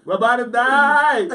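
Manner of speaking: loud, high, drawn-out wail; mock panic. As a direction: stretch the terrified cry, hammed-up. Manoah panics, acted.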